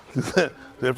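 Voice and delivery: ordinary delivery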